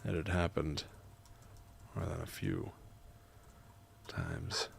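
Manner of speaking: measured, soft reading with long pauses; memoir narration. A narrator's reading. Writer narrating grandma's visit, measured memoir reading. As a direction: read it quietly, phrase by phrase.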